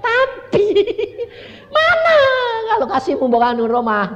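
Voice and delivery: high mock-tearful demand; whining. Demanding a house from God, high mock-tearful whine. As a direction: wail the demand like a small child. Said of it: "pokoknya ngerengek terus".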